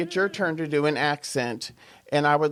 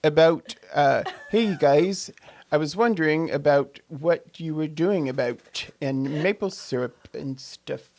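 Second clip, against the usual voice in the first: put-on Canadian with stressed "About"; Canadian accent. Shown as a demonstration. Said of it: "going to do my Canadian now".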